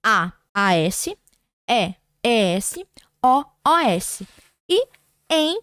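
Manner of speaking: slow, letter-by-letter dictation; slow spelled endings. Shown as a demonstration. Teacher to students listing the oxytone endings, slowly. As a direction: spell each ending out for the class. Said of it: "terminadas em".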